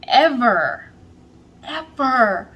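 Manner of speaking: emphatic repeated ever; stress on ever. Real, not acted. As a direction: hit both ever hard, dropping at the end.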